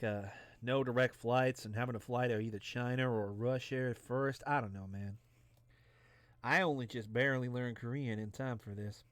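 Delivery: ordinary talk, nothing marked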